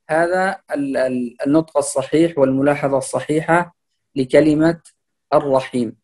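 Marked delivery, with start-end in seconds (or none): none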